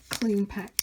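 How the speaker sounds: ordinary talk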